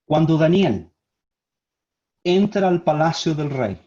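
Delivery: measured phrases parted by long pauses; slow and deliberate. Real, not acted. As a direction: let each phrase land before going on. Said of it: "Lo voy a decir lentamente". (lentamente, con pausas largas)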